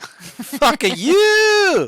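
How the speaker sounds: high, long-drawn silly jeer; mock taunt. Game master echoing Kieran's player at the monster, mock taunt. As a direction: stretch the last word way out, high.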